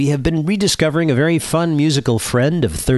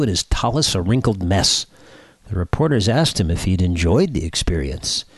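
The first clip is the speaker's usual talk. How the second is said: normal talking voice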